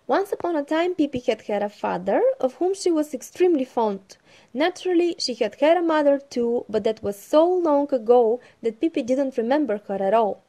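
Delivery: loud, lively storytelling voice; story narration. Acted. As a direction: read it out like a bedtime storybook. Reads the Pippi story aloud.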